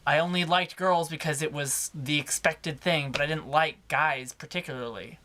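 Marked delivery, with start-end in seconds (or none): none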